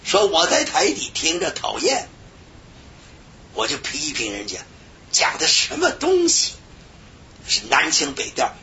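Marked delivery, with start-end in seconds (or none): none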